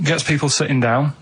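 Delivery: ordinary talk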